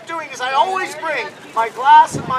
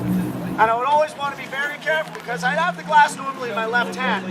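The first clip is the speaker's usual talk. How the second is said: ordinary delivery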